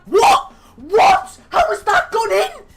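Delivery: high, loud shocked cry of what; can't believe it went in; squealing outrage at the goal; outraged disbelief. Real, not acted.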